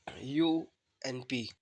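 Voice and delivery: यू एन पी spelled very slowly; very slow spelled-out letters; slow spelling. Shown as a demonstration. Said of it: "इसको अप पढ़ेंगे".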